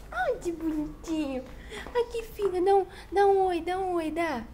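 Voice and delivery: high, loud cooing to a pet; talking to a pet. Real, not acted. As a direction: coo sweetly at the little animal.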